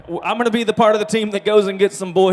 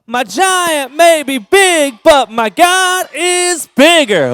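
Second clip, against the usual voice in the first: repeating louder and sillier; 5 semitones higher, 5 dB louder, much slower than usual. Shown as a demonstration. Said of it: "I think you can say it louder".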